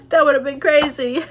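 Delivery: high-pitched, said through real laughter; laughing. Real, not acted. Speaker amused at her near car blow-up over missed text, laughing. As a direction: laugh your way through the words. Laughs at own overreaction story.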